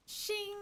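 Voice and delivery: high mouth sound effect; playful sword-shine sound; mimicked shing. Real, not acted. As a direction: make a bright shing noise. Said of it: "sound effect".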